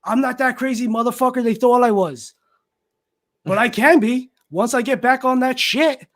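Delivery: loud hotel neighbor on phone. Retold or imitated. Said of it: "on his phone saying".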